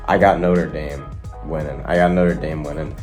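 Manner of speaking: ordinary talk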